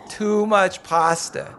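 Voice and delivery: drawn out, dry jab; slow teasing retort. Acted. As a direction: tease him slowly and dryly. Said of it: "I retorted".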